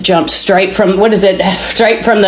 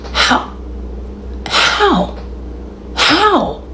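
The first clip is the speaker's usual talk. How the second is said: high, small, bewildered questioning; stunned disbelief; 9 semitones higher, 6 dB quieter, much slower than usual. Retold or imitated. Woman standing freed on the roadside, stunned disbelief. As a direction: ask it softly, rising in pitch, dumbfounded.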